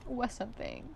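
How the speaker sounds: fighting not to cry, breathy and hushed; holding back tears. Real, not acted. Vlogger recalling being seen as good, softly near tears. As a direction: voice dropping soft and near tears. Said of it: "I'm trying not to cry".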